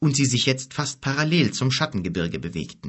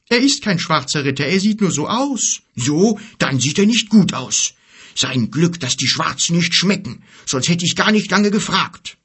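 high, loud storybook argument; bickering creatures; 7 semitones higher, 6 dB louder than usual. Acted. Giant and bear squabbling over the stranger, bickering. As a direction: argue it out in raised character voices.

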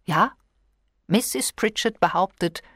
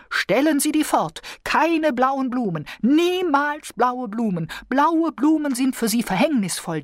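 mock cry of warning; 9 semitones higher than usual. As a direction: proclaim it like a seer crying doom. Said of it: "zeigte mit dem Finger darauf und rief".

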